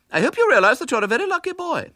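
high, prim lady's voice; a polite spinster forcing kindness. A narrator's reading. Miss Milton to the disguised boy, high and prim.

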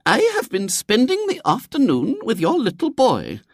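very high, formal lady's voice; prim lady visitor. A narrator's reading. Visitor addresses William's father, prim and high. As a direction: open an accusation with stiff formality.

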